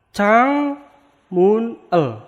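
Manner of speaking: Chang mun el said split apart, Chang pitched high; separate syllables. Shown as a demonstration. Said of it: "maka jadinya".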